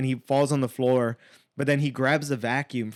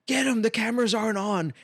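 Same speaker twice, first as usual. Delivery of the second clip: imitated yelling clerk; 9 semitones higher than usual. Store clerk urging on help against robber, mock yell. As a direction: imitate a woman calling out mid-fight.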